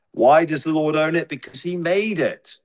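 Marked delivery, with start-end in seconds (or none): none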